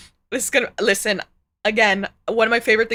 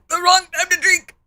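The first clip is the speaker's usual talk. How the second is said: disgusted groan; 8 semitones higher, 6 dB louder, slower than usual. Real, not acted. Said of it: "I have the bad chills".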